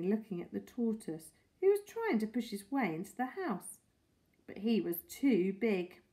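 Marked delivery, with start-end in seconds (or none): none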